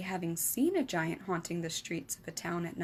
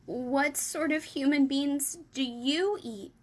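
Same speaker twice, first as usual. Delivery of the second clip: trembling; 7 semitones higher, 4 dB louder, slower than usual. Acted.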